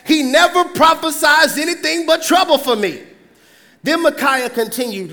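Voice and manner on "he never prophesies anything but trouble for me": higher and louder, whining; loud complaint. Acted. King loudly complaining about Micaiah. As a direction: complain indignantly.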